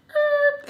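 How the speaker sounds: high, loud honk imitation; single high-pitched vocal honk; loud car honk. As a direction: imitate a car horn blaring. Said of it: "It's a loud honk".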